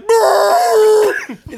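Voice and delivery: loud high growl; dog growl. Retold or imitated. Dogs reacting.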